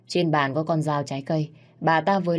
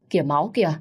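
louder alarmed cry; shriek; 4 dB louder, faster than usual. A narrator's reading. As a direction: cry out at the sight of blood. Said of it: "Con Lan nó hét lên".

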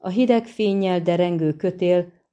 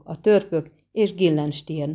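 plain reading voice

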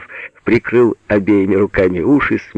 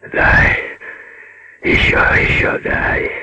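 slow, toneless growled plea; muffled growl; slower than usual. A narrator's reading. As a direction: growl for more from deep in the throat. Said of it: "глухо прорычал Пётр". (глухое рычание)

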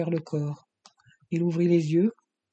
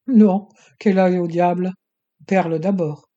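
dismissive outburst; 2 semitones higher, 8 dB louder than usual. Acted. Villain dismisses the girl coldly.

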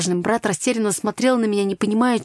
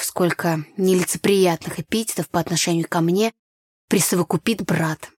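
plain reading voice

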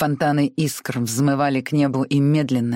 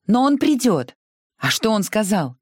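urgent; 8 semitones higher than usual. Acted. Scarlett urgent.

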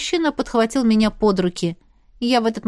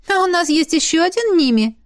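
high-pitched teasing retort; amused snort; 8 semitones higher, 3 dB louder than usual. A narrator's reading. Friend teasing about the brother's name, scoffing. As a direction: scoff playfully. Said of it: "хмыкнула Алис".

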